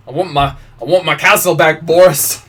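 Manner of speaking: loud, mock-regal complaint; regal demand. Queen loudly demanding her castle. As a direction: demand it back like a spoiled queen.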